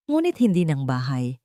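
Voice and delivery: plain reading voice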